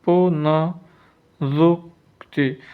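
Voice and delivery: पुनरुक्ति stretched into पु-न-रुक्-ति; slow, gapped syllables in पुनरुक्ति; slow dictation. Shown as a demonstration. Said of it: "पुनरुक्ति ऐसे लिखेंगे".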